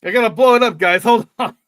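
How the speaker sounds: high, loud pretend holler; hammed-up shout to hold everyone up; mock calling out. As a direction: call to the others in mock urgency. Pretends to call out while inflating boat.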